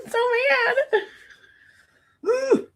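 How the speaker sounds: so mad squeaked very high, playful; squeaky whine. Real, not acted. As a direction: whine it like a cartoon.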